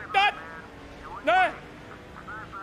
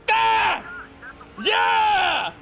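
celebratory yelling; 8 semitones higher, 6 dB louder, much slower than usual. Real, not acted. Drag race finish, yelling.

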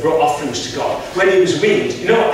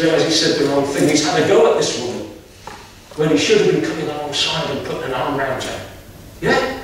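ordinary talk, nothing marked

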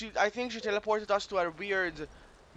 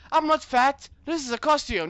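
higher, louder protest; indignant child character; 7 semitones higher, 6 dB louder than usual. Acted. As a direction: object hotly to being called fat. Voices a child protesting an insult.

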